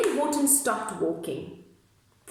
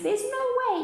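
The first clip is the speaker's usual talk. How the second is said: put-on much higher squeaky baby voice; baby kangaroo. Baby kangaroo echoes its mother, high squeaky voice. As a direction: pipe it up like a tiny joey. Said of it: "Even the kangaroo's baby says".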